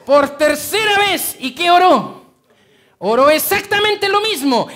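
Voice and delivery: loud, high, ringing sermon voice; fervent pulpit cry; full-force preaching. Acted. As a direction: preach it out to the whole church.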